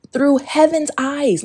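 normal talking voice

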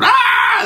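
imitating two men screaming at each other; mock yell. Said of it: "They're yelling".

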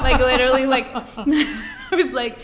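laughing through the words. Real, not acted. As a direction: giggle while speaking. Laughing at food captions.

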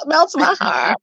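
slow, swooning sigh of delight; playful swoon; melting adoration. Retold or imitated. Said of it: "And I'm like".

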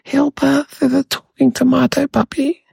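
imitating panicked kids in a high voice; high, retold alarm without extra volume; mock cry for help. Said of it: "Everyone started crying out".

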